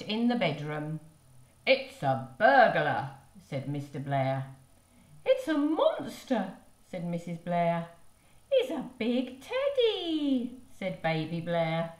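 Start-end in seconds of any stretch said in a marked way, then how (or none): 1.7-3.1 louder alarmed cry; alarmed father. Acted. Father alarmed at a burglar, loud cry. 5.2-6.5 alarmed mother. Acted. 8.5-10.6 put-on high, slow, louder toddler voice; delighted little child; baby voice. Baby seeing a big teddy, high toddler voice.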